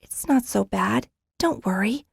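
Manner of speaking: put-on little girl softly reassuring her grandfather; smaller voice. A narrator's reading. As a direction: shrink the voice, reassuring. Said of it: "In a smaller voice".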